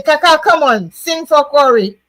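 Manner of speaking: quoting a comment. Retold or imitated. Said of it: "Lucita is saying".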